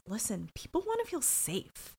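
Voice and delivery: hushed acted confidence; leaning-in confiding. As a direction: drop the volume as if leaning in close. Nick leans in, voiced low.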